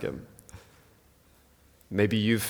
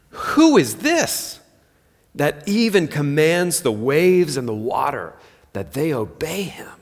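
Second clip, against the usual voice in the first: awed disbelief; 3 semitones higher than usual. Retold or imitated. Disciples marvel after the storm.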